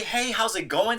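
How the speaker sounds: mock chirpy front desk welcome; cheerful receptionist greeting. Showing how a receptionist should greet, high and sing-song. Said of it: "Smile and greet".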